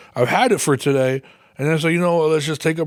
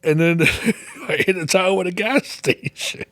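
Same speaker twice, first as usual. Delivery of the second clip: laughing; 5 semitones higher than usual. Real, not acted. Laughing telling the punchline.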